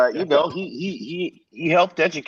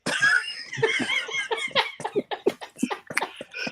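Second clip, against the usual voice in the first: big burst of laughter; 7 dB quieter than usual. Real, not acted. Storyteller bursting out laughing at the memory. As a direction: crack up at the punchline.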